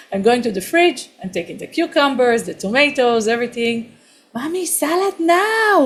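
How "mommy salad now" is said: put-on high demanding child's yell; imitating a screaming toddler. Daughter demands salad from mommy, high mock yell. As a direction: play the three-year-old yelling for her salad, pitched high. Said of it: "She started to scream at me".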